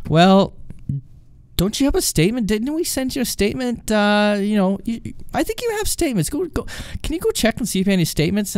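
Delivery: high-pitched, hesitant suggestion; agent stalling. Retold or imitated. Agent stalling to end call.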